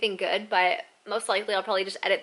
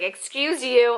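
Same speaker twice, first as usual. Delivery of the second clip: raised, louder mock-grumpy retort; 4 semitones higher, 7 dB louder, much slower than usual. Tired traveler to someone who bumped into her, grumpy. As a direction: re-enact a huffy, indignant comeback. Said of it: "like, all grumpy".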